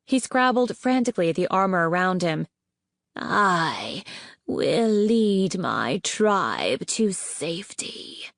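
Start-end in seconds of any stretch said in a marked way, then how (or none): 3.2-8.3 a slow, menacing hiss. Acted. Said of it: "the queen hissed".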